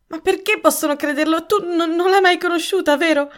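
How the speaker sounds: high, agitated questioning; wife begging for reassurance; anxious pleading. A narrator's reading. Wife anxiously questions accused husband.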